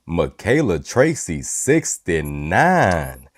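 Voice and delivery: slow, smooth late-night radio voice; midnight voice. Pretend. Host greeting a viewer by name, in a slow put-on midnight voice. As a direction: draw the name out seductively, mock-sultry. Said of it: "I can't be talking like that".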